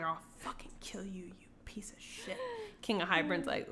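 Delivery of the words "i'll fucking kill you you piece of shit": imitating a drowning woman's vow; very soft, menacing mock whisper; whispered threat. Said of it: "She's like".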